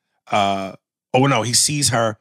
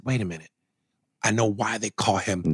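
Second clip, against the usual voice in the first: low, hushed realisation; retold dawning realisation; 4 semitones lower, 7 dB quieter than usual. Viewer working out the nickname, hushed realisation. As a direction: drop low and soft as it clicks. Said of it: "I said".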